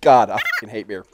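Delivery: grossed out by a bitter taste; grimacing after a bad swallow; after bitter medicine. Real, not acted. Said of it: "That is disgusting".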